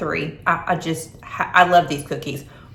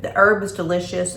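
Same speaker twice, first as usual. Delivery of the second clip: ordinary delivery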